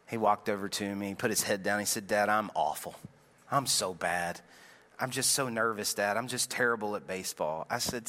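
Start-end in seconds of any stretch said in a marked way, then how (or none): 2.1-7.6 put-on low, soft, downcast child near tears; a hint of a tearful kid; mild imitated crying. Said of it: "He starts crying a little bit".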